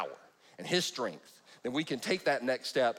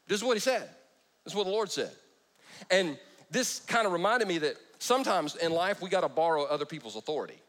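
usual speaking voice

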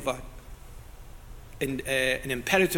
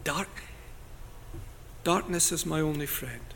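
bleak murmur; 9 dB quieter than usual. Retold or imitated. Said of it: "and you think".

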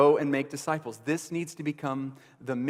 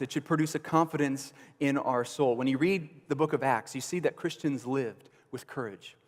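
ordinary talk

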